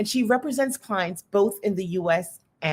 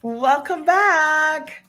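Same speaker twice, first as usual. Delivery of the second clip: stretched, high and loud greeting; drawn-out welcome; 9 semitones higher, 6 dB louder, much slower than usual. Real, not acted. Host welcoming her guest back, stretched high and loud.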